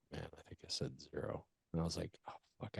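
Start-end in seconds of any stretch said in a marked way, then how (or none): 0.1-1.5 much softer, lower, muttering to himself; muttered aside. Retold or imitated. Panicked self-talk muttered to himself. 2.3-2.8 whispered curse. Retold or imitated. Realizing his mistake, under his breath.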